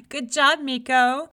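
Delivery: loud, mocking jeer after a laugh. A narrator's reading. Older brother ribbing his younger brother over the fallen tree, mocking. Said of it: "his brother mocked".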